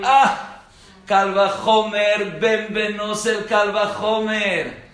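high, loud, slow "Kal Vajomer"; awed exclamation. Retold or imitated. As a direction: savor the logic with wonder. Rabino exclama admirado. (exclamación admirada, lenta y fuerte)

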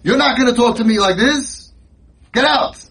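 mock outraged father; parent throwing kid out. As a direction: snap back at a rude son and kick him out. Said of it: "And you say".

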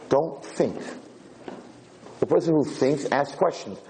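usual speaking voice

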